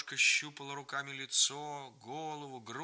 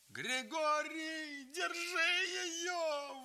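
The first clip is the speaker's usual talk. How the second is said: very high, slow, wailing cry; howling plea; much slower than usual. A narrator's reading. Grandfather begging Grigory to stop her, howling. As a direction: wail it desperately. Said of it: "выл дедушка".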